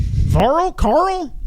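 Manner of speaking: high incredulous squeak. Real, not acted. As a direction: squeak it out in disbelief. Mock disbelief at Varl.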